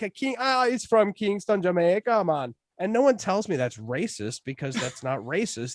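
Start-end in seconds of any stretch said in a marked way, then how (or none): 0.4-2.6 slow, high-pitched mock Jamaican accent. Shown as a demonstration. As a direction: draw it out in a put-on Jamaican accent. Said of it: "That's how Jamaican people speak".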